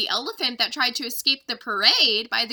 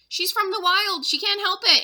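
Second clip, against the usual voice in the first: loud, much higher pleading; louder anxious plea; pleading cry; 8 semitones higher, 3 dB louder than usual. Acted. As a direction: defend the elephant to merchants. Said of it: "Annie cried".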